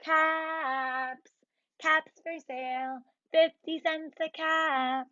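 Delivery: lightly sung street call; peddler call. Shown as a demonstration. Teacher showing kids the peddler's call. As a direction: demo the cap-seller's cry for kids. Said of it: "you can go around and say".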